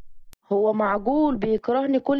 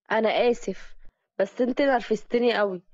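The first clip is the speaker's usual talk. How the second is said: normal talking voice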